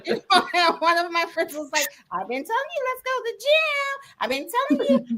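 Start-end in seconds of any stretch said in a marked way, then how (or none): 2.1-5.2 high, singsong urging; mock a friend pleading in a raised pitch; nagging friend imitation. Friend nagging her, acted out.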